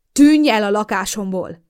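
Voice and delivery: loud, slow, forceful order; vehement. Acted. [name] vehemently orders her boyfriend out, loud and slow. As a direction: throw him out with force. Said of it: "ismételtem immár vehemensebben".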